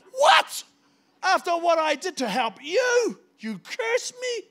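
high, loud, slow indignant shout; outraged shout. Retold or imitated. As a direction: bellow it in stunned outrage at the ingratitude. Outraged reaction to being cursed.

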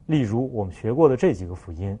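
usual speaking voice

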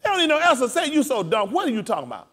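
full-force preaching, high and loud. Real, not acted. Preacher telling the congregation he will walk among them, preaching. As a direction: preach it with driving intensity.

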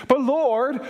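high, loud, drawn-out whine of protest; but Lord, said as a high, loud, slow complaint; protesting Peter. Acted. Peter voiced protesting to Jesus.

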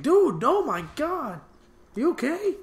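high, slow, overdone panic; mock panicked friend. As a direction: gasp it out like a worried buddy. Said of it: "Glasses is like".

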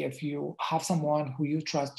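ordinary delivery

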